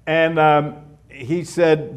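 usual speaking voice

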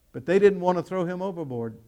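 ordinary talk

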